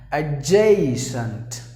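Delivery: Adjacent slowly, uh-JAY-sent, long A; drawn out Adjacent with a long A; slow correct pronunciation. Shown as a demonstration. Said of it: "you have to pronounce the word as".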